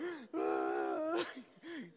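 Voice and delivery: a sharp, choked gasp on the edge of tears; retold overwhelmed gasp; tearful gasp. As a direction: gasp as if the tears are just starting. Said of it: "and I'm just like".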